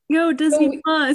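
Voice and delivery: high, loud jokey call to Disney Plus; mock call-out. Teasing Disney Plus about its subtitles, loud and high. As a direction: hail the streaming service like an old friend. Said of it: "I was just like".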